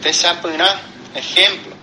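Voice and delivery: normal talking voice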